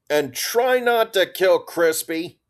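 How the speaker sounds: high, slow call down a slope. A narrator's reading. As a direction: call out to someone walking away. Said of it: "Narvaez called down".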